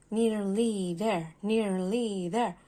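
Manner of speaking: slow, lightly gasping words; a touch of breathless old engine; mild gasp. Acted. Old engine nearly at the top, mildly gasping. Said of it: "gasped the old engine".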